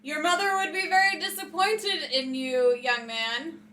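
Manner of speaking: loud, high motherly reprimand; yelled scolding. Acted. Hero shaming the attacker, loud scolding yell. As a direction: yell it like telling off a naughty boy. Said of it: "I'm going to yell out".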